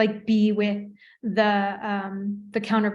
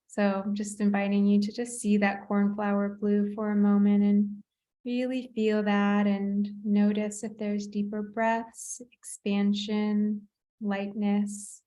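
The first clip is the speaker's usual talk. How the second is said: guided meditation. Acted. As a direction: ease them into calm, unhurried. Therapist guides visualization.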